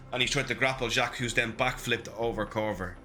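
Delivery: ordinary talk, nothing marked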